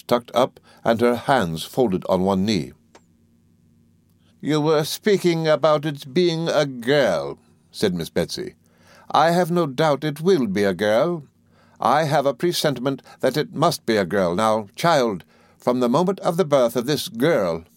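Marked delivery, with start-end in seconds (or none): 4.4-7.4 high, slow, deliberate aunt; resume grandly as the aunt; insistent aunt. A narrator's reading. Voices Miss Betsy. 9.1-17.6 high-pitched, emphatic aunt; insistent aunt. A narrator's reading. Voices Miss Betsy.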